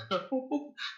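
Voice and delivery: high, gleeful giggle; high villain giggle. Acted. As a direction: giggle at the cruelty. Host laughter continues.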